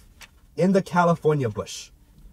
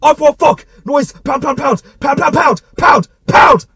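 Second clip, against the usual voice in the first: shouting; 10 semitones higher, 11 dB louder, slower than usual. Acted. Narrator shouting at charging deer.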